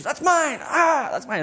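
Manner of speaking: high-pitched mock child yells; squabbling toddlers. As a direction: squabble like small children over a toy. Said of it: "And then Grace goes".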